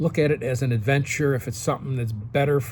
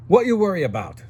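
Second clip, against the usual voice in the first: a light imitation of an Italian grandfather's accent, higher and louder; 5 semitones higher, 4 dB louder than usual. Grandfather Giovanni advising young Gregory, in a light Italian accent. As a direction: lightly voice an old Italian man's accent giving advice. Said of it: "In his Italian accent".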